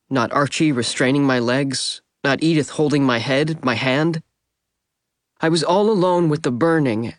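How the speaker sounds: plain reading voice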